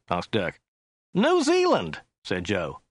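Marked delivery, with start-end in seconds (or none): 1.1-2.0 high, whiny chauffeur; Joe's whiny voice. A narrator's reading. Driver describing the client to his passenger, high and whiny. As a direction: pitch it up into a whine. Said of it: "His voice was rather high and whiny".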